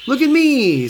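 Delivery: high, loud show-off cry; proud call. A narrator's reading. Ketchup shows off to family while skating, proud call. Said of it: "said Ketchup proudly".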